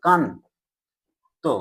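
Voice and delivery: "কান", long pause, then "তো"; syllable by syllable. Shown as a demonstration. Teacher asking what you pronounce, "কান" and "তো" said apart. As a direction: split it into "কান" and "তো". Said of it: "কি উচ্চারণ করছো?".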